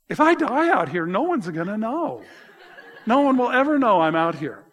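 half-laughing at his own panic; mock dread; retold panicked thought. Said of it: "And I thought".

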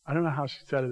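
ordinary delivery

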